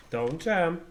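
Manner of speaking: ordinary delivery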